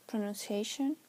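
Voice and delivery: ordinary talk, nothing marked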